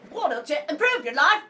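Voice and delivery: imitating the author's Scottish voice, badly; bad Scottish accent. Cohost mocks the audiobook author's reading, in a botched Scottish accent. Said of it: "I don't know how to do a Scottish accent".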